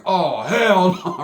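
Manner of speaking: drawn-out, disbelieving hell no; a very slow, loud oh hell no. Retold or imitated. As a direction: stretch it out in disbelief. Reacting as the stabbing starts.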